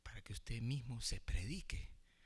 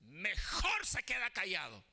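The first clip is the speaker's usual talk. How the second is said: full-force preaching; 14 semitones higher, 6 dB louder than usual. Real, not acted. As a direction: proclaim it fervently to the congregation.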